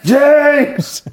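high, loud shouted name; retold shouting. Tommy heckling his mate on live TV, loud high shout. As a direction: holler his name across the room. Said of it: "he's going".

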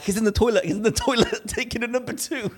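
high-pitched giddy retelling, breaking into laughter; excited report. Retold or imitated. Friend running out to report the golfer found, giddy and high.

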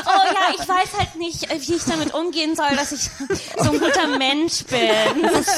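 high, giggling through the words; laughing. Real, not acted. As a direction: laugh while bragging. Joking about being a good person.

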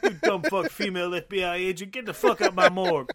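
loud, exasperated mock rant; mock angry coroner. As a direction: bark at the agent in a hammed-up rage. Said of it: "mocking coroner yelling at FBI agent".

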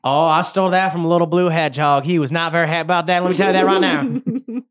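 a gleeful Southern shopkeeper bragging; Southern shopkeeper accent. Acted. I'm regretting the Southern accent thing.

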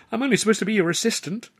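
quick, high-pitched grumble; grumbling. A narrator's reading. Left-out assistant to her partner, grumbling. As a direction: complain fast in a high voice. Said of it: "Tilly grumbled".

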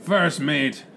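slow, rumbling pirate drawl; pirate captain. Acted. Captain worries about sea monsters, pirate drawl. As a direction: draw out the vowels like an old sea dog.

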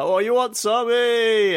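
high, loud mock challenge; mocking tough guy. Bystander filming him mocks his tough-guy challenge, high and loud. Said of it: "just being like".

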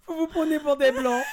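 high, laughter-filled mock accusation; cracking up while quoting; laughing. Real, not acted. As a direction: say it through a burst of laughter.

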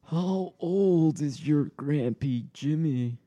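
put-on high, slow creaky old man. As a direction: stretch it out like a frail old man. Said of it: "So this is the old man voice".

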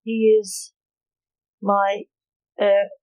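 halting, pausing between words; hesitant. A narrator's reading. Lance grasping for an alibi, hesitant. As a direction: stall for an answer with long gaps. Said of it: "who was having a hard time thinking".

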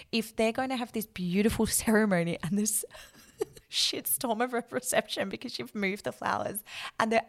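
chuckling through the words; laughing. Real, not acted. Florist expert laughing while describing a messy reception. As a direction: laugh as you picture the mess.

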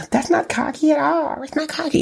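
high mocking pundit voice; squeaky mock pundit voice; mocking Chris Broussard. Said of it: "listened to the Chris Broussard".

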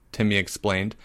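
ordinary delivery, nothing marked

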